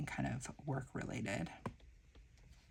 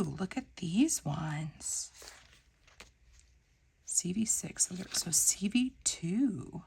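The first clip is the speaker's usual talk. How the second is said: whispering; 2 semitones higher, slower than usual. Real, not acted. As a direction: whisper to yourself. Whispering while sorting stickers.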